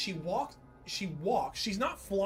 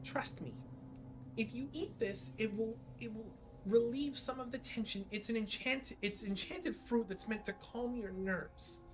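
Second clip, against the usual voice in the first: high, soft coaxing fairy; soothing pixie voice; 6 semitones higher, 7 dB quieter than usual. Acted. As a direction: coax gently like a tiny pixie. GM voicing pixie.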